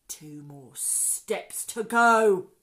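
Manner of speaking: slow line swelling to loud; emphatic robber. A narrator's reading. Hugh telling Len they are nearly there, slow and emphatic. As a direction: stretch it out and land hard on the end.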